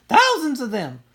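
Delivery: wailing. A narrator's reading. Sister telling brother what chased her, frightened wail. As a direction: wail it out in terror. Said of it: "she wailed".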